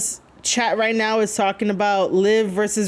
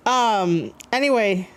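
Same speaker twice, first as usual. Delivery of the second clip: normal talking voice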